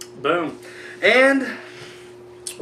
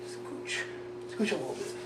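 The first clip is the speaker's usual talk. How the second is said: whisper; 3 semitones lower, 19 dB quieter than usual. Real, not acted. Shifting seats before recording.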